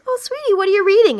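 high, quick doting mom voice. Acted. Mom asks her little boy about his book, sweet and high.

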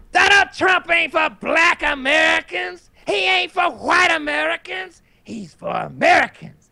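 high, loud, slow preacher shout; preacher hyping crowd. Retold or imitated. Imitating the rally minister, loud high preaching shout.

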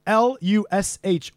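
ordinary delivery

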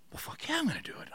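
breathless high whisper, barely voiced; a thrilled whispered yes; excited whisper. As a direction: hiss it out like a giddy secret. Retold eager reply to offer.

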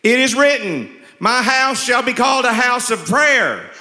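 high, loud, stagey Jesus line; hammy loud recital; first stiff audition. Retold or imitated. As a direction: overact it like a nervous first reading. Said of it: "he gives me this script and I say".

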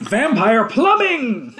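high, loud, drawn-out exclamation; mock announcement. Naming the wrecked water system, mock-dramatic announcement. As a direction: belt it out slowly like a title.

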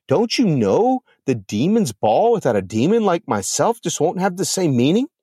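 how dare she, wounded pride; indignant disbelief. Acted. Lilith learns she's uninvited.

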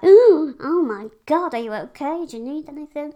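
high, loud gasp of alarm, fussing; alarmed stranger. Retold or imitated. Stranger hearing of a broken rib, shocked and loud. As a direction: mimic a shocked stranger's fuss. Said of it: "they'd be like".

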